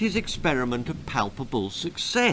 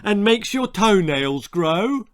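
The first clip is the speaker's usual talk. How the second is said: half-witted husband voice; 5 semitones higher, 4 dB louder than usual. Acted. Husband character voiced in story.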